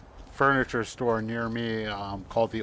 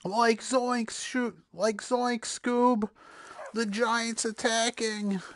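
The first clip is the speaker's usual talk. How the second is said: much higher, slow goofy Shaggy voice; Shaggy impression; 12 semitones higher, much slower than usual. Player mocks himself for fleeing the giant, high slow Shaggy voice. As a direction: whine it out like a panicky Shaggy. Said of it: "Ultra Instinct Shaggy".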